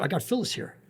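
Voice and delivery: a covering tone to fool the boss; acting casual. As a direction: play it off like it was the plan all along. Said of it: "he's acting like he did it on purpose".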